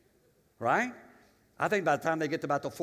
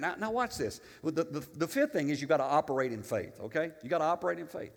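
usual speaking voice

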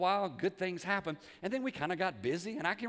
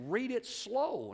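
slow and higher, stretching SLOW; God's slow instruction; 7 semitones higher, much slower than usual. Retold or imitated. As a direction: draw out "Read it slow" as an instruction. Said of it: "God said".

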